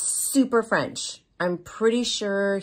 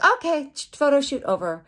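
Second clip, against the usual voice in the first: higher, louder quoted photographer; abrupt announcement; 10 semitones higher, 7 dB louder than usual. Retold or imitated. Photographer ending the shoot on the model, abrupt announcement. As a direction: call it out suddenly.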